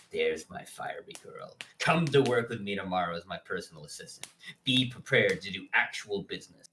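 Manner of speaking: low, brisk boss voice; lightly voiced controlling boss; commanding boss. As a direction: drop the pitch and give orders briskly.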